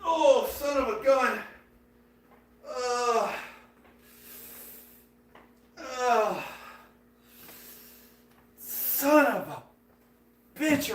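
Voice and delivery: slow, strained pained groan. Real, not acted. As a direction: push the words through the pain. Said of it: "I rocked my head".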